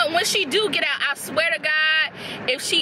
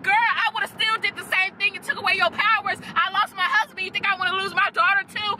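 mocking Iris and daughter; 7 semitones higher than usual. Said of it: "She like".

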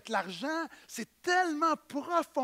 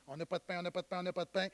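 mock mumbling; 4 semitones lower, 7 dB quieter, much faster than usual. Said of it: "Jésus leur enseigne".

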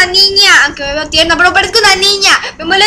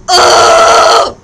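annoyed grunt. Real, not acted. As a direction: grunt out in disgust. Gruñido.

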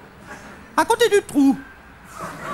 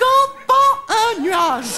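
drawn-out punchline; 3 semitones higher, much slower than usual. Acted. Punchline delivered slowly.

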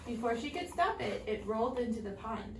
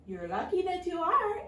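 higher, louder frog; 8 semitones higher, 6 dB louder than usual. A narrator's reading. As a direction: answer her a little cheekily. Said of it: "answered the frog".

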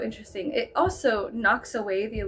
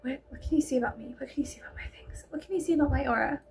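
fast, breathless whisper; 5 dB quieter, much faster than usual. Retold or imitated. Girl secretly asking the speaker for a reading, whispered fast. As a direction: hurry the questions out in a hushed whisper. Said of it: "because she was like".

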